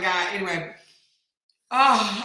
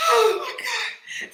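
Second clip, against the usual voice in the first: high, slow wail after a laughing fit; helpless laughter; 7 dB louder, slower than usual. Real, not acted. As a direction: gasp it out through real laughter. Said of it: "laughed like that".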